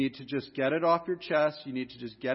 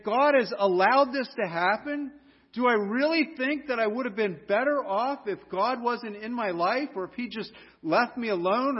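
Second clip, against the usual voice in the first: rising, higher and louder sermon voice, pressing each question home; fervent preaching; 8 semitones higher, 6 dB louder than usual. Acted. Pastor warning the congregation against grumbling at God, preaching hard. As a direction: build the warning like a pulpit climax.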